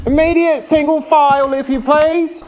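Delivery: high, stern guard call; stern guard holler. Acted. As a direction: bark the order like a guard. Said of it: "a stern voice hollered".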